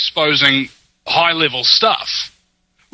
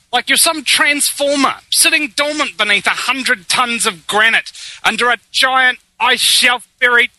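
dramatic; 6 semitones higher than usual. Acted. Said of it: "That's, that's very dramatic".

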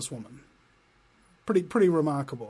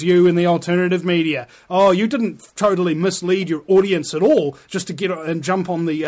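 louder, biting sarcasm at the researchers; sarcastic taunt; 5 dB louder than usual. Acted. Taunting the alternative media researchers, loud and sarcastic. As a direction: jeer at them with fake praise.

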